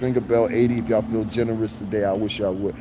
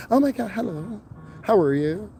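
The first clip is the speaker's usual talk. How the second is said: fake-friendly greeting voice, pitched way up; code-switching voice; 5 semitones higher than usual. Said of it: "they're like".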